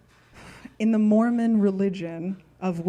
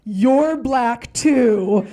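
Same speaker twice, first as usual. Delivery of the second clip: very slow, much louder reveal; loud slow punchline; 2 semitones higher, 9 dB louder, much slower than usual. Retold or imitated. Narrator telling the little cousin the twist, very slow and loud. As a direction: drop it hard and slow.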